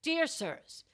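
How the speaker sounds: lightly voiced prim correspondent; letter writer. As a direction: begin a pompous letter reading.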